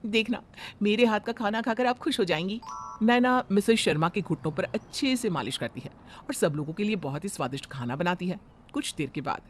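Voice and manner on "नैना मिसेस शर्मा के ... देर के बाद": lower, softer storyteller voice; story narration. A narrator's reading. Narrator describing the bride's massage and cooking, calm. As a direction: narrate the change gently.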